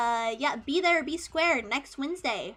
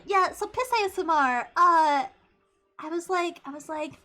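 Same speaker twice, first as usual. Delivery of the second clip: normal talking voice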